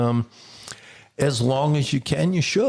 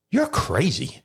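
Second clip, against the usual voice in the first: imitated disbelief; 6 semitones higher, slower than usual. Partner looks at the host after his request, exasperated. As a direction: quote her verdict in a raised pitch.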